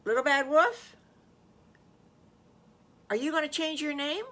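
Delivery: lightly voiced high, inquisitive youngster; high-pitched wondering question; curious little pig. Squiggly puts a curious question.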